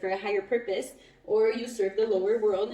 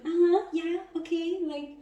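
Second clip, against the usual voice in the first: higher, airy voice of a pushover; mocking a clingy partner, higher; mock eager yes-man; 8 semitones higher than usual. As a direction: play the eager people-pleaser, higher. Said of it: "It just goes like".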